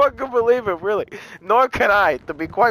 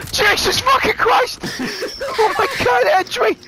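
a much higher, slower outcry of disbelief; shocked outburst. Real, not acted. Gamer reacting as his teammate guns down their friend, with a high, shocked cry. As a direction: blurt it out, caught off guard.

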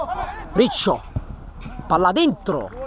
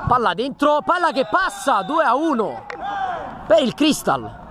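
excited goal call; 7 semitones higher than usual. Real, not acted. Live goal call, two to one.

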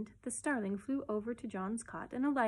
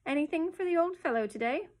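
high, coaxing question; coaxing, lifted voice, angling for a favour; wheedling; 8 semitones higher, 6 dB louder than usual. A narrator's reading. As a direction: wheedle for a treat. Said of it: "wheedling voice".

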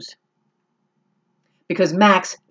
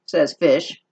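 ordinary delivery

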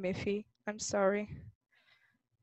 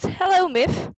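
higher, louder squawked greeting; parrot shout; 7 semitones higher, 6 dB louder, slower than usual. Acted. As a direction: call it out like a parrot. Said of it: "the parrot shouted".

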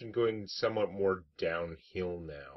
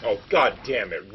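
frustrated outburst; 3 semitones higher, 9 dB louder, slower than usual. Real, not acted. Frustrated at card result.

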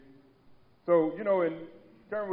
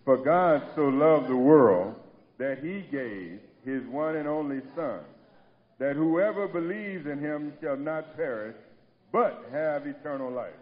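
loud, steady reading aloud together; congregational scripture reading; 5 dB louder than usual. Real, not acted. Pastor leading the congregation in the reading, loud and steady. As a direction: lead the church in reading the verse. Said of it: "Three sixteen together. Okay, let's go".

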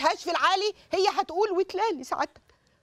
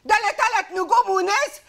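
higher and louder, indignant ranting; angry outburst; 6 semitones higher, 5 dB louder than usual. Acted. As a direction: she refuses to calm down. Warden rejects daughter's calming.

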